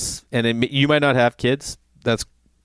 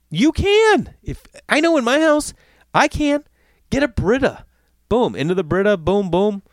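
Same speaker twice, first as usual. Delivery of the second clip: emphatic rant; 8 semitones higher than usual. Real, not acted. Ranting insistence.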